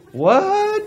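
high, loud incredulous yelp; hammed-up disbelief, voice shooting up; mock astonishment. Retelling their exit from the tunnel, astonished yelp. Retold astonishment at sudden sunshine.

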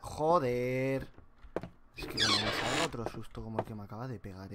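ordinary talk

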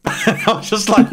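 talking through a laugh; giggling through the words. Real, not acted. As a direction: laugh it out mid-sentence. Laughs while retelling.

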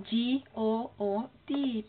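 each letter spelled out slowly. Shown as a demonstration. Streamer spells out the word for viewers, slowly. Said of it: "spelling out a word".

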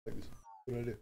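ordinary talk

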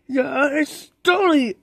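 ordinary talk

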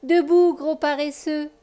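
put-on a girl cheerfully waking her dog; playful teasing wake-up; joyful wake-up call. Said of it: "lui dit-elle joyeusement".

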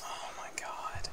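breathless whispered awe. Real, not acted. Gamer whispering to viewers at a find. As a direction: hush it like you can't believe it.

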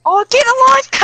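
sudden high yell in panic; frantic shout. Real, not acted. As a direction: yell it out in alarm. Frantic shout during gunfight.

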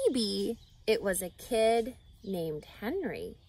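ordinary delivery, nothing marked

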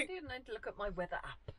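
quiet whispered aside; whispering. Real, not acted. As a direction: whisper it to yourself. Aside while checking weather app.

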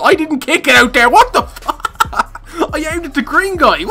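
high-pitched baffled protest; exasperated disbelief. Real, not acted. Gamer protesting at horrible lag, exasperated disbelief. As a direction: voice shooting up high in helpless confusion.

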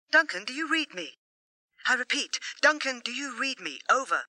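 calling over a crackling radio; radio static voice. A narrator's reading. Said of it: "The voice was thick with static".

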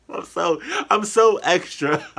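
high, loud, laughing self-mockery. Real, not acted. Reactor laughing at being so extra, laughing.

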